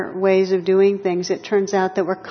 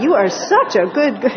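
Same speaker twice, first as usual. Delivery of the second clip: louder, higher doting praise for a pet; gushing dog praise; 3 semitones higher, 6 dB louder than usual. Retold or imitated. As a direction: pour it on like a doting owner. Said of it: "listening to this voice going".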